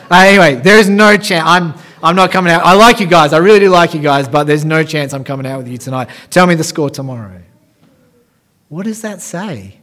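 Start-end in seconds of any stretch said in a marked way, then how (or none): 0.1-7.4 louder, beaming husband turning his mates down; eager loud refusal. Retold or imitated. Husband at work refusing the game night, proud loud refusal. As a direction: announce it proudly, no chance he's coming. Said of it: "and he says".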